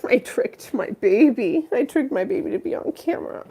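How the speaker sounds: gleeful squeal about the cat; delighted giggling confession; giggly glee. Real, not acted. Laughing at tricking her cat.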